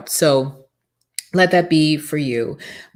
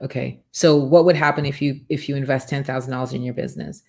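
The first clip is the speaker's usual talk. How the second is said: usual speaking voice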